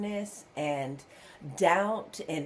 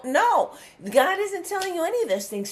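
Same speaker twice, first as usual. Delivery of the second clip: emphatic rebuttal; 9 semitones higher, 3 dB louder, faster than usual. Real, not acted.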